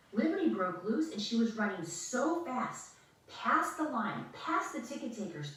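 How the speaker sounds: ordinary delivery, nothing marked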